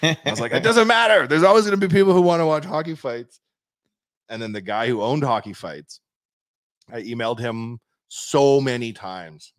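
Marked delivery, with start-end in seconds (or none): 0.5-3.4 high, loud re-enacted protest; insistent rebuttal. Founder arguing back at the naysayers, loud insistent rebuttal. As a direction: override the doubters with raised voice.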